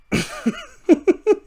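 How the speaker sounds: high burst of giggles; helpless giggle. Real, not acted. Host about to try the voice, helpless high giggle. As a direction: crack up before the attempt. Cracks up before attempting the voice.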